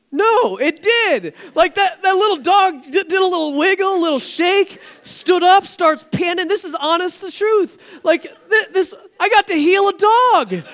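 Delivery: high, loud, thrilled telling; excited. Real, not acted. Pastor tells congregation the dog recovered, excited and loud. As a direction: burst out with delight at the miracle.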